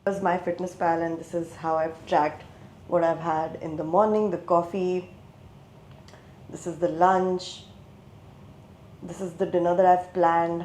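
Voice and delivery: muffled, mic in a pocket; muffled audio. Real, not acted. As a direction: sound buried under cloth. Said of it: "my mic was in my pocket all this while".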